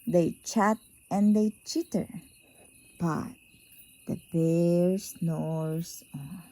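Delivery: plain reading voice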